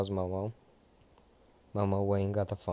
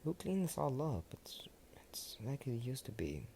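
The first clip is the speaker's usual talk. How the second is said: awed whisper; 5 semitones higher, 7 dB quieter than usual. Acted. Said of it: "Momo saying".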